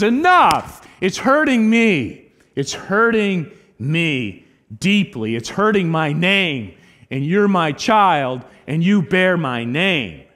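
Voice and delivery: loud, high, slow cry of hurt; God speaking out, loud and slow; God's rebuke. Acted. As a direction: thunder it as a wounded God. Preacher voices God's rebuke.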